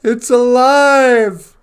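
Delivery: stretched, high, loud triumphant cry; mad scientist cry. Retold or imitated. Victor seeing the monster rise, a mad scientist's shout. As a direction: howl it out like a mad doctor. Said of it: "And Victor's like".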